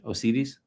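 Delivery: Osiris asked Spanish-style, oh-SEE-rees, rising; questioning Spanish-style Osiris, oh-SEE-rees; Spanish pronunciation. Shown as a demonstration. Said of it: "how would you pronounce this in Spanish".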